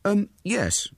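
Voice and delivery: put-on high, hesitant boy's yes; hesitant fib. A narrator's reading. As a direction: agree reluctantly after a small hesitation. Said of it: "after a moment's struggle with his conscience".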